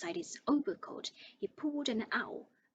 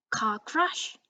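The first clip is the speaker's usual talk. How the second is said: loud, outraged roar; 9 dB louder than usual. Acted. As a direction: bellow it in disbelief. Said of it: "roared Hagrid".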